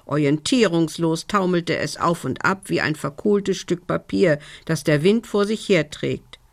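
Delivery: plain reading voice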